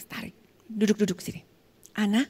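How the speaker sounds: whispered, pulling her close; urgent whisper. Retold or imitated. Pastor pulling the abused woman aside, whispering urgently. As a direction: hush her and sit her down.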